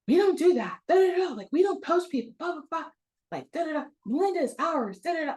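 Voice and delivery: high-pitched mock angry rant; hammed-up territorial outburst with blah-blah filler; imitating furious boss. Boss fighting the sub over her, imitated in a high, angry rant. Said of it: "My boss was furious".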